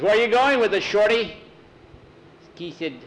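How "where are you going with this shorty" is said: loud, high taunt; big player's mocking challenge, voice raised. A narrator's reading. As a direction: jeer at the little guy. Big guy taunts small player.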